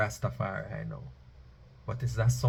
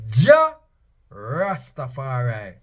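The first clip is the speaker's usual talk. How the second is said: proclamation; 6 dB louder, much slower than usual. Acted. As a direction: declare the name with power. Proclaiming the name.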